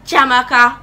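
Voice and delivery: mother's summoning call; mom calling out. Retold or imitated. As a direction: call the child from another room. Mother calling child in story.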